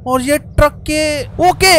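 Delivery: ordinary delivery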